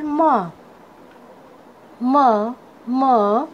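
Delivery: म as a lone "muh", spaced out; phonic letter sound. Shown as a demonstration. Teacher showing students the sound of M, phonic letter sound. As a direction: sound out म as M starts "mango". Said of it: "एम का sound होता है".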